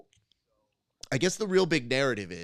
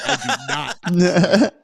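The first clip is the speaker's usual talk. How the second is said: laughing; 7 semitones higher, 13 dB louder, much slower than usual. Retold or imitated. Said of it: "I was like".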